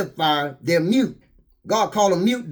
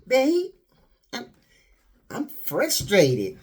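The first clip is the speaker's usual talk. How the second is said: suggestive whine; 4 semitones higher, much slower than usual. Retold or imitated. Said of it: "they'll tell you".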